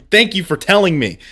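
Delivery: sarcastic thanks to chat for telling him to calm down. Real, not acted. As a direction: thank them while clearly meaning the opposite.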